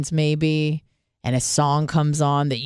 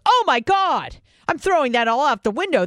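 higher, fast mock revelation; mock convert; 7 semitones higher than usual. Imagined listener suddenly converted, mock gushing. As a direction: gush like a sudden believer. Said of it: "you're like".

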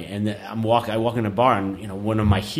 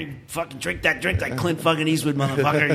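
high-pitched mock drill-sergeant bark; a hammed-up boot camp tirade, pitched way up; R. Lee Ermey drill sergeant; 5 semitones higher than usual. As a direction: play the drill instructor yelling inside your head. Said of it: "Lee Ermey in my head screaming at me".

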